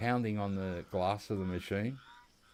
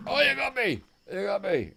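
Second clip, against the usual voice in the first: startled yelp; 11 semitones higher, 6 dB louder than usual. Real, not acted. Startled by an animal in game.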